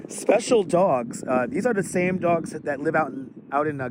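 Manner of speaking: ordinary delivery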